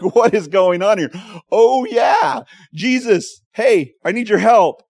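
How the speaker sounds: loud, high, flustered cry for help; a frantic call to Jesus, half laughing; panicked plea. Retold or imitated. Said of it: "I'm like".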